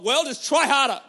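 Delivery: loud, high mocking scold. Mocking the wrong answer to struggling believers, loud mock scold. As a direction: bark it like a dismissive critic. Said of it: "the answer is not".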